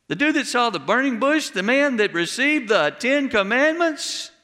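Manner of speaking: ordinary delivery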